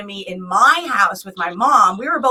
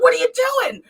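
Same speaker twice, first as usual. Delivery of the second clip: higher, puzzled mom; mom's voice; 13 semitones higher, faster than usual. Retold or imitated. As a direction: play the confused mother, much higher. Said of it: "She's like".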